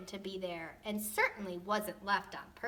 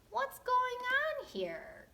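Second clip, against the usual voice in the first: much higher, slow drawn out whine; exhausted groan; much slower than usual. Acted. Tired Goldilocks groaning at the giant beds. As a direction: whine it out, worn out. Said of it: "groaned the exhausted girl".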